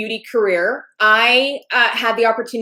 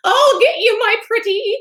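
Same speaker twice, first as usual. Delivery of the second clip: high-pitched wicked witch impression; 10 semitones higher than usual. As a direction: put on a high cackly witch voice. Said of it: "my voice is starting to, like".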